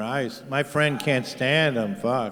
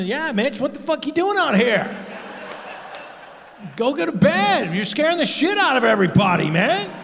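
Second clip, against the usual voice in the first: mock exasperated yell; 9 semitones higher than usual. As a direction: yell at him to get to bed. Comic yelling at Mitch.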